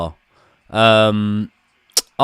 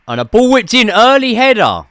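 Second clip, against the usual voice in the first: higher and louder, calling the cross as it happens; sudden excited play-by-play on the chance; excited live call of the early header; excited commentary; 11 semitones higher, 6 dB louder than usual. Real, not acted.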